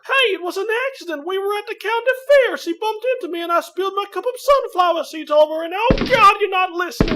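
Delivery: high, fast, tumbling explanations; frantic excuses. Acted. A young man babbling excuses to an angry father. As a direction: rush the excuse out in a panic.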